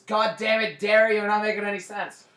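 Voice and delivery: loud, high-pitched exasperated yell; Greg yelling. Retold or imitated. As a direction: yell it like a fed-up friend bursting in. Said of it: "Greg walks in and just yells".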